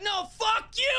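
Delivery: high, shouted retort; mock internet fight, shouted back; hammed-up shout. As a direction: yell it back even harder. Acted troll argument on Reddit.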